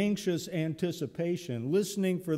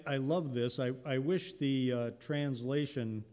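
usual speaking voice

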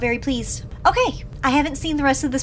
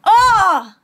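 surprised laugh; 8 semitones higher, 6 dB louder than usual. Real, not acted.

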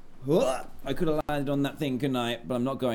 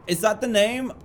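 higher, louder, unsure about "Wato"; surprised; 10 semitones higher, 5 dB louder than usual. Real, not acted. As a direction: catch yourself in a startled question. Catching own mispronunciation.

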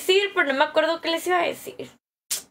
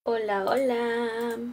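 slow, low, softer odd voice; odd put-on voice; 3 semitones lower, 5 dB quieter, much slower than usual. Shown as a demonstration. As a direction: drag out a strange greeting. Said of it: "Ay, qué raro hice la voz". (voz rara y lenta)

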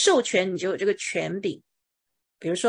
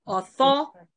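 "Author-" cut off after a raised, stressed THOR; stress on second syllable; 5 semitones higher than usual. Shown as a demonstration. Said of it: "重音在第二个音节". (“Author-”第二音节 THOR 重读、升高)

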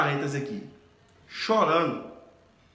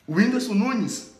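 crying fan; 6 dB louder, much slower than usual. Retold or imitated. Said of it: "eu já tava chorando".

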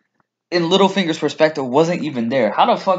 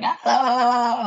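mock Dothraki yell; 9 semitones higher than usual. Fan recounting the last episode, mock Dothraki yelling. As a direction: imitate a charging horde at half volume. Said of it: "there's Dothraki yelling".

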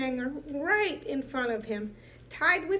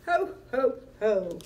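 booming jolly Santa laugh; 5 dB louder than usual. A narrator's reading.

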